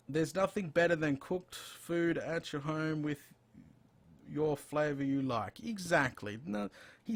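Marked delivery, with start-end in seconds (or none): none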